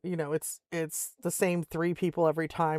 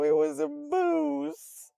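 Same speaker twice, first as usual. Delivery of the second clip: slow, high drawn-out admission; slowly explaining the moose to the delivery guy; deadpan drawn out; 8 semitones higher, much slower than usual. Retold or imitated. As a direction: stretch it out, sheepish. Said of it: "And I go".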